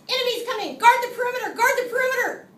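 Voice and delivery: loud, high alarm call; shouting warning. Retold or imitated. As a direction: shout it from the lookout tower.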